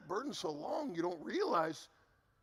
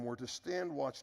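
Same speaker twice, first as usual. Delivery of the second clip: ordinary talk, nothing marked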